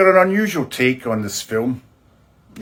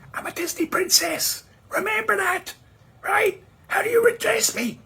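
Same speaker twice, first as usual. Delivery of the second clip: much higher, a mock dainty princess voice; Disney princess voice. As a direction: play a prissy cartoon princess demanding a title.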